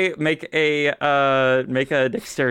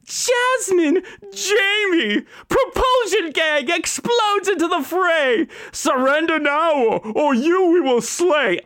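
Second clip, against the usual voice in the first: high, declaiming villain chant; hammed-up anime villain recital; Team Rocket motto; 13 semitones higher than usual. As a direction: recite the motto with cartoon flair. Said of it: "The Tabaxi is Meowth".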